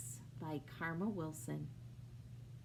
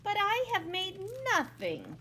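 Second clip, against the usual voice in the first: sighing; 9 semitones higher, 5 dB louder than usual. Acted. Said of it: "he adds with a sigh".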